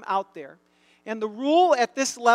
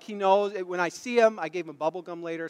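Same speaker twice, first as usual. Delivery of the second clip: ordinary delivery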